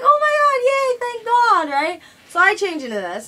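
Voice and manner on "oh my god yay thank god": much higher and louder, slowing on the thanks; thrilled relief. Retold or imitated. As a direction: squeal with relief at the win. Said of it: "And I'm like".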